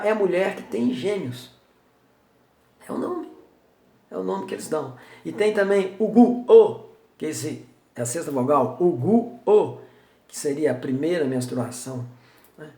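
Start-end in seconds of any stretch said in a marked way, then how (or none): none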